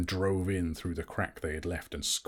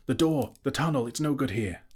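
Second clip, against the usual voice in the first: higher and louder, breathless alarm; urgent gasp; 7 semitones higher, 4 dB louder than usual. A narrator's reading. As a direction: push the warning out in a panic. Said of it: "he gasped".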